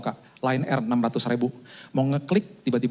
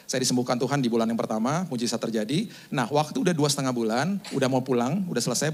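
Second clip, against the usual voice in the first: ordinary talk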